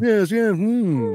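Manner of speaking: high-pitched put-on upper-crust millionaire accent; Thurston Howell. Host aping the lecturer's accent, upper-crust millionaire impression. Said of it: "like, a Thurston Howell accent".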